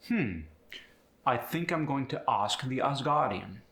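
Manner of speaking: British accent, AH in ask and Asgardian; British broad A; British AH sound. Shown as a demonstration. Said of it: "gets the ah sound in there".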